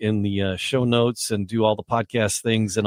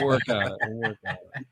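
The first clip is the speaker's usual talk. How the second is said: chuckling through it; laughing; 2 semitones lower, 4 dB quieter, faster than usual. Real, not acted. Laughing at the joke.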